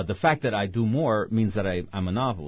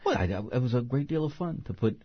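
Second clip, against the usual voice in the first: ordinary talk, nothing marked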